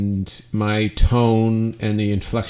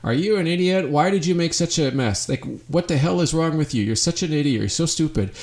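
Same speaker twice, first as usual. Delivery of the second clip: higher-pitched, hammed-up scolding without real volume; mock angry yelling; 7 semitones higher, faster than usual. Partner berating you over a mess, mock angry high yell. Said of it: "yelling at you saying".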